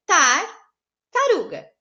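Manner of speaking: Tartaruga said slowly with a pause after the first part; syllable-split tartaruga. Shown as a demonstration. Teacher correcting the exercise, splitting tartaruga slowly. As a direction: stretch out Tartaruga and break it early. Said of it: "a prof separou algumas assim".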